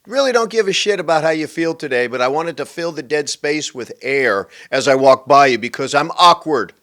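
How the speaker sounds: exaggerated tactless candour; blunt translation. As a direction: rattle off the real meaning, punching the end.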